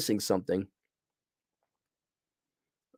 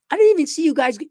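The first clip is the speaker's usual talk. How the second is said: much higher, louder mock disbelief; incredulous outburst; 5 dB louder, faster than usual. Reviewer mocking the rushed romance: loud disbelief. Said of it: "I'm like".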